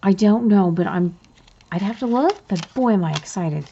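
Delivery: usual speaking voice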